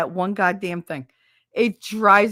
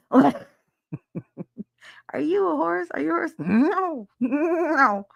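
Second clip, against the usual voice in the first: high-pitched teasing questions; high mock baby talk; 9 semitones higher, slower than usual. Streamer mocking a horse meme in a high silly voice. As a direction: coo at it like a silly pet voice.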